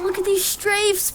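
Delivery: high-pitched goofy exclamation; playful high voice. Acted. Excited playful showing off moves.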